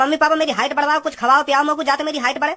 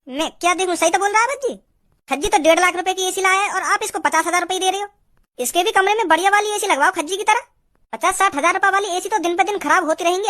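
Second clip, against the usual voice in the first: higher, nagging mother voice siding with her son; mother's voice; 4 semitones higher than usual. Acted. Mother takes her son's side with her husband, higher nagging voice. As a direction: play the mom scolding a stingy husband. Man voicing the mother in sketch.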